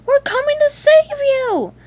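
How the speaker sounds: high, loud rallying shout. A narrator's reading. SpongeBob promising Gary a rescue, shouting. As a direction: call out at the top of the voice. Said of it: "he shouted".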